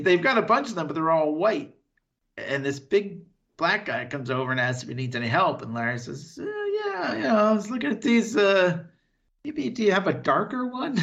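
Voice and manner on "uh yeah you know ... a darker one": higher-pitched, hesitant imitation; awkward hesitant request. Co-host imitating Larry asking for a darker jockey, awkward hesitant request. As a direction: hedge it in a raised voice, imitating an awkward customer.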